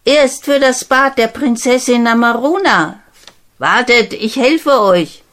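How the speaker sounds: offering help brightly; lightly voiced friendly girl; helpful girl. Girl answers the prince.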